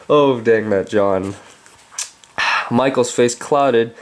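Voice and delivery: plain reading voice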